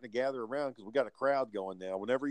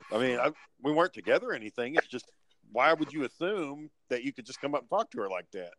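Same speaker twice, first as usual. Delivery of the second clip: normal talking voice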